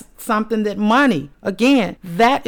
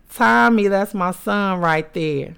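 ordinary talk

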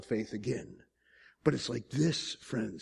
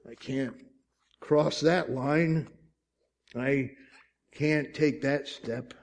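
hesitant faith; slower than usual. Acted. Fledgling believer afraid to step out, slow and hesitant. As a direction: stall between the words.

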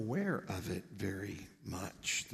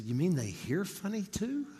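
lightly voiced little girl, higher pitch; a child's puzzled question, pitched up; 8 semitones higher than usual. Little girl works out her mother's answer, lightly voiced child. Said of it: "her little girl thought about it".

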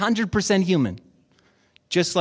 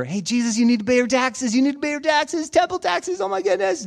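mock fretting; 6 semitones higher than usual. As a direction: pitch climbing into frantic nagging. Nagging Jesus about unpaid taxes.

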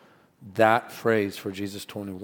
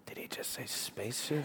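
stifled whisper; 2 semitones higher, 14 dB quieter than usual. Retold or imitated. Whispered aside at a funeral.

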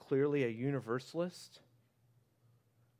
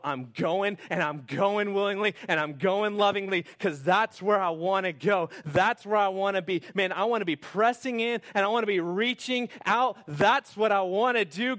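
loud, high pleading prayer; voicing a believer's eager surrender to God; impassioned surrender; 7 semitones higher, 10 dB louder than usual. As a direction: build it up like a fervent vow. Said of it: "your response was".

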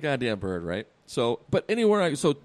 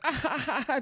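high cackling ha ha ha from the bird; bird's mocking laugh; mock bird cackle; 9 semitones higher, 3 dB quieter than usual. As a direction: laugh it off like a smug peacock. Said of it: "And he's just like".

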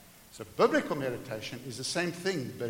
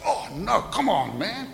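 exasperated cry; 5 semitones higher, 6 dB louder, slower than usual. Acted. Acted slip in kitchen.

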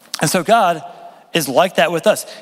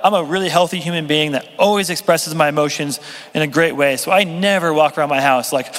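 usual speaking voice

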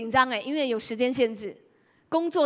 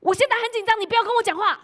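high, loud snappish retort; irritated child; 10 semitones higher, 6 dB louder, faster than usual. Retold or imitated. Gaming son snapping at his mother, loud and high. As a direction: brush mom off like a stressed kid mid-game. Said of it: "他会讲说".

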